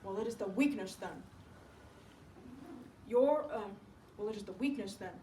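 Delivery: a hint of an authoritative doctor; lightly voiced doctor. Doctor advising Sue on his patient, measured and firm. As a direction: pronounce the verdict in a lightly put-on doctor's voice.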